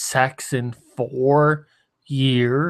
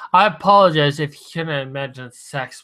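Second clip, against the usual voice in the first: ordinary delivery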